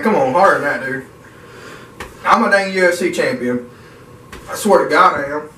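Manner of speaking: ordinary talk, nothing marked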